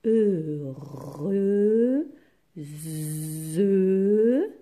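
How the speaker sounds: E, re, ze held long and loud, one by one; drawn-out syllables. Shown as a demonstration. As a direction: stretch each of E, re, ze for a spelling class. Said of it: "il faut quatre syllabes".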